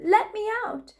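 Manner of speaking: high shouted plea; shout to be let out. A narrator's reading. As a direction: yell to be let out of the cellar. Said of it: "She bellowed".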